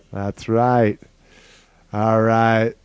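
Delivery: usual speaking voice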